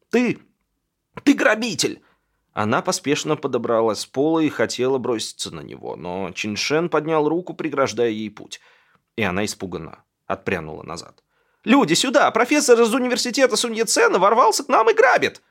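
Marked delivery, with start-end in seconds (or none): 0.1-2.0 high, loud, halting outburst; stammer, then cry out the accusation; outraged accusation. A narrator's reading. Thrown woman accuses the intruder. 11.6-15.4 very high, loud alarm call; screaming for help. A narrator's reading.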